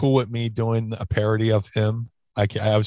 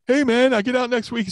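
much higher, lightly voiced buddy; prison buddy; 13 semitones higher than usual. A buddy phones the wrestler from prison, higher voice. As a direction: tip him off, chummy.

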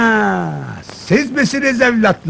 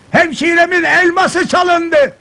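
raised pitch, slow emphatic announcement; loud secret-telling; 5 semitones higher, slower than usual. Acted. Minister tells agents of the theft, loud secret-telling. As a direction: proclaim a secret far too openly. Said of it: "Ne bağırıyorsun be?".

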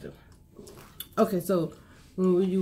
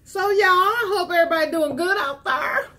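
country accent; 7 semitones higher than usual. Real, not acted. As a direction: lay on a Southern twang. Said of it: "She just adopted her country accent".

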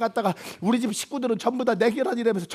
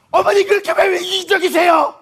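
yelling at mother-in-law; 7 semitones higher, 7 dB louder than usual. Acted. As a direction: let it burst out.

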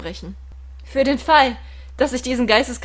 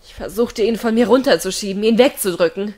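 ordinary delivery

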